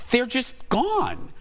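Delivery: high, slow imitation of baffled mom; imitated baffled mom. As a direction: draw it out high, as puzzled mom. Mom baffled by vanished charges.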